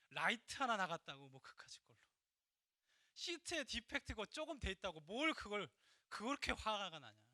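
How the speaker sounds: slow, emphatic scolding as God; God rebuking him. Retold or imitated. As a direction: voice God chiding a petty servant. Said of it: "하나님께서 말씀해 주세요". (하나님의 꾸짖는 말투로)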